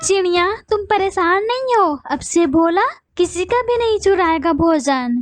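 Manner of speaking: high bird voice soothing the crying sparrow. Acted. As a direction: comfort her, then curse the thief with sudden heat. Consoling bird again. (रोती चिड़िया को दिलासा देती ऊँची आवाज़ वाली चिड़िया)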